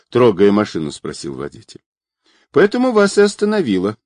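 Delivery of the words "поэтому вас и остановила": put-on high, quick young woman's voice; sighing girl. A narrator's reading. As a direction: answer fast in a high girlish pitch. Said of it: "вздохнув, ответила девушка".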